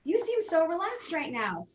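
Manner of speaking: high, surprised "Oh" from a coworker; mock surprised coworker. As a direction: imitate a startled colleague lightly. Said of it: "they're like".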